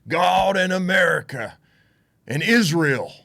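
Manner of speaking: loud, high Southern Texas preacher proclaiming; booming mock Southern pulpit cry; Southern preacher accent. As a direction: belt it out like an old Southern Baptist preacher. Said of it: "talks with an accent, and he says".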